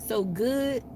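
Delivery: ordinary talk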